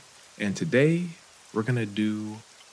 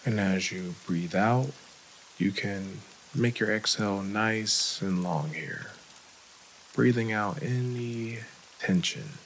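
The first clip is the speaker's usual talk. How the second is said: guided meditation. Real, not acted. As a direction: lead the breathing unhurriedly, with long gaps.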